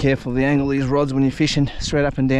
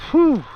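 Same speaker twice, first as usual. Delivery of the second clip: relieved whew; 8 dB louder than usual. Real, not acted. Relief after landing catfish.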